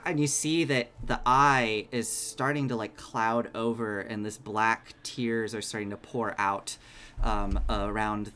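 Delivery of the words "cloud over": usual speaking voice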